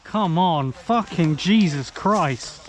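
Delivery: loud, slow furious groan; exasperated shout. Real, not acted. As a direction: drag it out in anger. Frustrated player in game.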